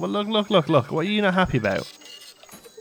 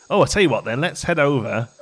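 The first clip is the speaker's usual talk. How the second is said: ordinary talk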